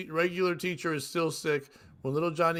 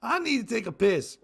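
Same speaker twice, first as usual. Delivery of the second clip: higher-pitched call to the teacher; lightly raised, mock schoolboy holler; mild mock yell; 7 semitones higher than usual. Little Johnny calls to his teacher from class, mock yell. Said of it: "He yelled out".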